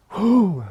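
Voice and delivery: loud, high "whew" of a flying object; imitated whoosh. Man retelling a bottle thrown at him, imitated whoosh. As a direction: mimic something hurtling past.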